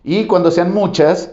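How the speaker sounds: ordinary delivery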